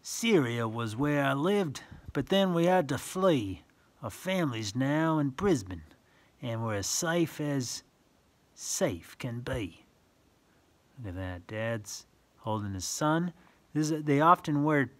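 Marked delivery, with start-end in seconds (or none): 0.0-9.8 sing-song rhyming read-aloud; reading verse to children. Acted. Reading a picture book to a child, sing-song. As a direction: lilt the rhyme for little listeners.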